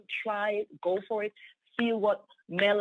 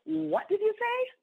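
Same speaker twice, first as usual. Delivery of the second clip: much higher, slow astonished question; 12 semitones higher than usual. Retold or imitated. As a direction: imitate her jaw-drop. Pastor stands up, astonished.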